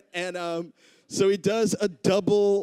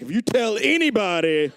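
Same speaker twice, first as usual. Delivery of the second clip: higher, louder mock threat; retold threat; 5 semitones higher, 5 dB louder than usual. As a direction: act out a menacing warning, pitched up. Retold threat to someone pushed down.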